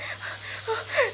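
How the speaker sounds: high, breathy hammed-up sob; imitating a little girl starting to cry; mock gasping sob. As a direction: fake a child's shaky crying gasp. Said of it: "she's like".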